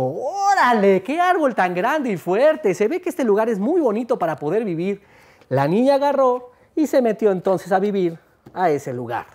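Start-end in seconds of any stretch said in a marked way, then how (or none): 0.0-5.0 put-on girl's voice. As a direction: marvel at the big tree like a girl. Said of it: "vio el árbol y dijo".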